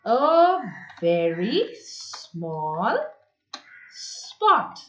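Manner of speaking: very slow, word by word for writing down; slow dictation. Shown as a demonstration. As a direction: stretch each word out with pauses, as if dictating. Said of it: "you will write".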